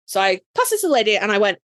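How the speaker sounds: usual speaking voice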